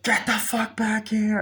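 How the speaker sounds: high, drawn-out imitation of a yell; mock angry order. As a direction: act out calling someone back, high and slow. Acted order from movie character.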